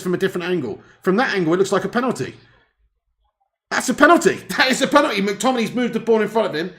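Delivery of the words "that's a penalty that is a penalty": much higher and louder, sure it's a pen; excited shout. Real, not acted. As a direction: yell it out as the penalty call. Excited commentary on penalty.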